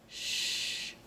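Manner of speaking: soft shh to quiet someone; a soft shush; hushing shh. Shown as a demonstration. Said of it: "they might say".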